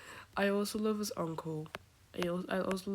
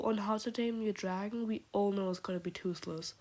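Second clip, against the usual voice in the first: normal talking voice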